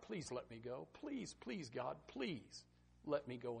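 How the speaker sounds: soft, mild tearful plea; a quiet pleading please; begging. Acted. Moses begging God to let him in, softly pleading. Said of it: "with tears, I believe Moses begged God".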